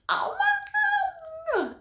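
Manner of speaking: extremely high, drawn-out squeal; mock horror. Appalled at her English accent, high squealed mock horror. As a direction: stretch it into a sky-high mock wail.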